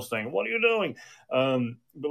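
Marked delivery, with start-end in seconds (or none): 0.3-1.0 a sudden high, imitated demand, not truly furious; mock angry challenge. Preacher imitates a church member confronting people, mock angry. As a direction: playfully mimic someone charging in like a mad bull. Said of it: "charging them like a mad bull".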